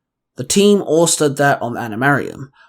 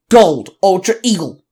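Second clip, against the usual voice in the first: louder, higher battle call; 4 semitones higher, 4 dB louder than usual. A narrator's reading. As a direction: shout the morph call. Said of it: "the Gold Ultra Ranger called out".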